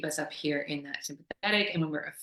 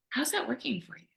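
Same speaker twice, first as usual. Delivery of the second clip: soft, higher-pitched gentle question; kindly; 7 semitones higher, 5 dB quieter than usual. Acted. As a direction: ask it kindly and tactfully, voice lifted and soft. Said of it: "really kindly say".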